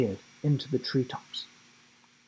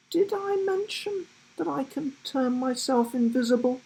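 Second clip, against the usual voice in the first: giraffe voice; 13 semitones higher than usual. A narrator's reading. Giraffe casually reveals.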